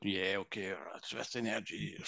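low, soft mock indifference; dismissive skeptic. Healer mocking his former ego mind, low dismissive mumble. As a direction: shrug it off as a know-nothing doubter.

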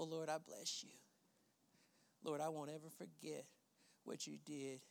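hushed, low, tender murmur to God; intimate prayer. Shown as a demonstration. Preacher showing congregation how to rest in God, hushed. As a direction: pray softly as if alone with the Lord.

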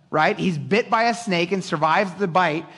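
ordinary delivery

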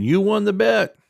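loud, high imitation of a coworker; retold call-out. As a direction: call it out like someone across the office. Quotes shout from office background.

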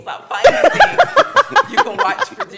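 a mom laughing at her son's TV habits; laughing plea. Retold or imitated. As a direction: beg him to stop while cracking up.

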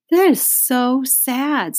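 slow, high-pitched sympathy; saddened. A narrator's reading. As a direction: draw it out sadly, pitched high. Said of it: "said Leslie".

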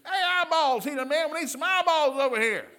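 high, very loud comic holler; mock scream. Blind beggar wanting eyeballs, comically screamed. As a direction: holler it wildly across a crowd. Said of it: "He didn't scream".

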